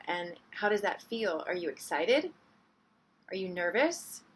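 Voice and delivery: low, soft check-in with unhurried pauses; guided meditation. Real, not acted. Teacher guiding the group to notice how they feel, soft meditation voice.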